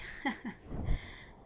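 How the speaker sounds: short, light laugh; amused chuckle. Acted. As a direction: laugh lightly at a shared liking. Amused that rider loves fishing.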